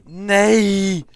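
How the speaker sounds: high, loud cry of no; Swedish yell. Pretend. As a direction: wail it in protest. Swedish outcry continues.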